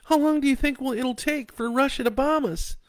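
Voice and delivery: high, loud frightened wife voice. A narrator's reading. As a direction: ask it shaking with dread. Wife asks in fear.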